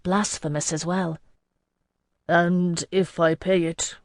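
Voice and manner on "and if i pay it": slow question with a slight tremor. A narrator's reading. Bouvard asking what follows payment, with a tremor. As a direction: draw it out, a touch unsteady. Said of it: "the tremor back in his voice".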